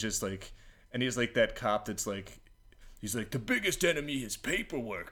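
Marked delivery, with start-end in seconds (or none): 3.3-5.1 higher-pitched exasperated cop impression; mock-angry cop. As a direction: gripe like a fed-up cop. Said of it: "he's so mad".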